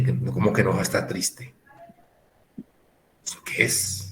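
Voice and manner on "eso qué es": whispered aside. Real, not acted. Incredulous aside.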